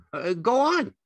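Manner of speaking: high, loud, eager simple fellow; Lenny, eager and childlike. A narrator's reading. Lenny prompting George to go on, high and eager.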